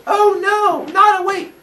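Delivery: high, loud sarcastic oh no; mock alarm. Cronus mocks his father's curse, sarcastic. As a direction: fake being scared.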